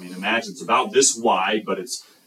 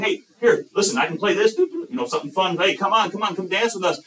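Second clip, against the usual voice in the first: much higher, lively coaxing; eager child's invitation; 9 semitones higher than usual. Acted. Child urging others to come dance, eager coaxing. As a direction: pitch it way up like a pleading playmate.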